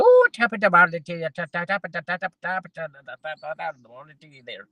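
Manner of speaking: put-on light Irish accent, fading to a murmur; imitating Irish neighbour. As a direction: mimic the Irish neighbour's chatter. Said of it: "she was Irish, she went".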